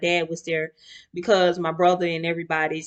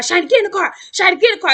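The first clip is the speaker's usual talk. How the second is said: high, loud repeated yell; 12 semitones higher, 8 dB louder, much faster than usual. Retold or imitated. Relatives shouting at her to get in the car. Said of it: "Everybody yelling at me".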